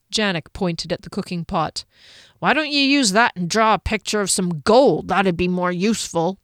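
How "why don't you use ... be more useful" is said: growly, grumbling dwarf voice; gruff dwarf. Acted. Dwarf telling halfling to draw gold instead, gruffly. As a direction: grumble out a practical suggestion.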